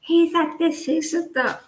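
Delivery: a hint of high gossipy chatter; gossiping women. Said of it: "when women talk a bit".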